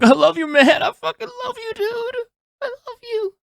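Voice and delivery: put-on high squealing drunk love declaration; drunken mock gush. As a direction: gush like a tipsy friend, laughing.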